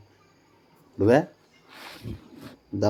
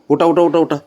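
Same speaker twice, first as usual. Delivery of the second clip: loud urging; 7 semitones higher, 7 dB louder than usual. Real, not acted. Urging pet to get up.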